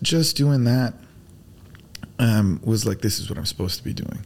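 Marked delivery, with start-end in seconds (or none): 3.0-4.2 whispered to himself; whispering. Retold or imitated. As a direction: breathe it like a revelation. Inner realization, hushed.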